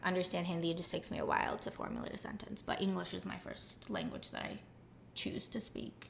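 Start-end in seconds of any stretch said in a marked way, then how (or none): 2.6-6.1 soft, breathy whispered joke; whispered aside. Acted. Guest whispering a joke to the host about her languages. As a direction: whisper it like a secret confession.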